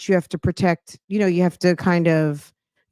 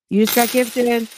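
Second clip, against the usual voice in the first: higher, showy gift announcement; hyped announcement; 4 semitones higher than usual. Shown as a demonstration. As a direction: sell it like a hype host. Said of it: "repeats with flair".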